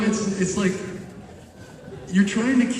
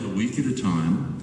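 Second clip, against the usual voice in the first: ordinary delivery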